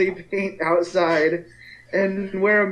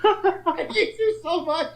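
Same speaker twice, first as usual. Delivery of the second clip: laughing; 12 semitones higher, 4 dB quieter than usual. Real, not acted. Groaning at a pun.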